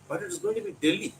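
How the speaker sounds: ordinary delivery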